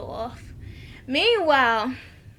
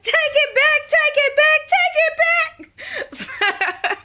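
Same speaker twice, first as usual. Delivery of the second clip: high, loud shriek, slowing into laughter; shrieking order; 14 semitones higher, 5 dB louder, slower than usual. Retold or imitated. Cookie beating Hakeem with a broom, screaming take it back. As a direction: scream it while half laughing.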